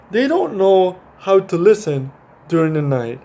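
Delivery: put-on animated read-aloud for kids; reading to children. A narrator's reading. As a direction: read it like a bedtime picture book. Reads picture book aloud.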